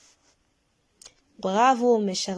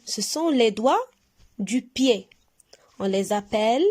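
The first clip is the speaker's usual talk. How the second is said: usual speaking voice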